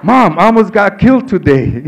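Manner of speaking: high, loud protest; shouted interruption. Retold or imitated. Son on payphone to ranting mother, shouting over her. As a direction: cut through her rant at full voice.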